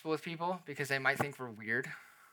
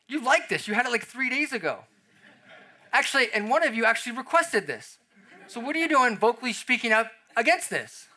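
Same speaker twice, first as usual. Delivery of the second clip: exasperated parent; 7 semitones higher, 5 dB louder than usual. Retold or imitated. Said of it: "And you're like".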